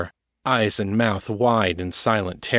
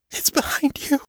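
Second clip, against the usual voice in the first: high breathless whisper; eerie whisper. A narrator's reading. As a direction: whisper the warning in dread. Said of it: "she said".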